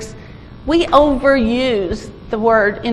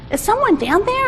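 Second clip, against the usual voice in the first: much higher, questioning call; first responder calling; 9 semitones higher than usual. Acted. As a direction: call down to check for someone. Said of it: "the first responder came by".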